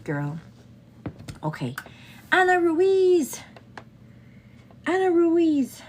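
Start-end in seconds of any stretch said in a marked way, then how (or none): 2.3-5.8 excited name call. Real, not acted. Greeting viewer by name.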